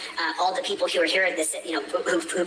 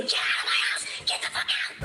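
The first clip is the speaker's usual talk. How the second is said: normal talking voice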